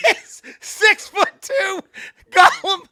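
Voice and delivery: high, slow, squeezed out through laughter; laughing hard. Real, not acted. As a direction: wheeze it out while cracking up. Cracking up at miscast Gollum.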